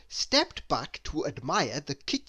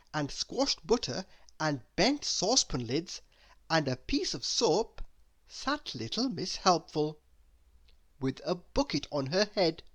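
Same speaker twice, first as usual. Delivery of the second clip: ordinary delivery, nothing marked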